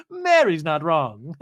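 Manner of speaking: slow, loud, drawn-out pronouncement; castmate impression. Castmate siding in a quarrel, slow hammed-up declaration. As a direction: draw it out loudly as a hammed-up verdict. Repeats the acted castmate's line.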